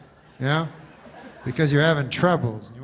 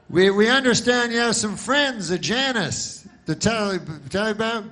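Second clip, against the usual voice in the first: mock news anchor; 8 semitones higher, 4 dB louder than usual. Anchor teasing next segment.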